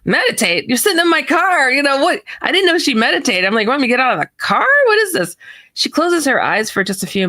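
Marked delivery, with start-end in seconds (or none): 0.0-1.7 acted-out indignant surprise; incredulous. Incredulous at friend's sudden request. 3.7-5.3 fast, high-pitched exasperation; rushed, rising bewilderment; baffled protest. Retold or imitated. Baffled retort to friend.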